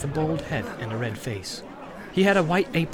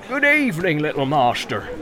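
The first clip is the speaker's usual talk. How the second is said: bustling innkeeper; 4 dB louder than usual. Acted. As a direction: greet the guest heartily. Innkeeper greets hobbit.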